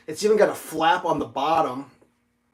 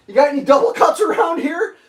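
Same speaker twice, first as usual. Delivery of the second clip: high, loud call across the store; 12 semitones higher, 9 dB louder than usual. Retold or imitated. Calling across the pawn shop to the clerk, loud. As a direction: shout out to find the clerk.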